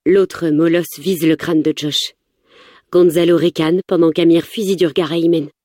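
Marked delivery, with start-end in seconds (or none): none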